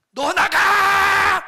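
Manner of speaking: drawn-out, very high, very loud yell; mother's shout. Retold or imitated. Mother screaming at her son to get out. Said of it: "하고 소리를 지르는 거예요".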